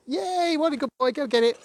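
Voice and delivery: high, bright squeal of praise for the dog; excited dog praise. Real, not acted. Trainer praising her dog for getting it right, in a high excited squeal. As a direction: cheer him on, way up high and loud.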